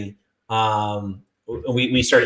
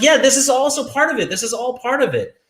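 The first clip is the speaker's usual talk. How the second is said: higher, eager mock fan; 12 semitones higher than usual. Mocking fans agreeing, high eager voice. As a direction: parody a fan gushing agreement. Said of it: "They're like".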